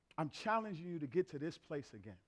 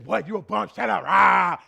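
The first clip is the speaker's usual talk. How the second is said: loud in-your-face street yelling; mock trash talk, shouted; 15 dB louder, slower than usual. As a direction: bark it like a rough ball player. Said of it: "They in your face".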